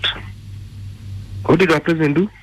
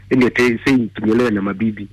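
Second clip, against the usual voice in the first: ordinary delivery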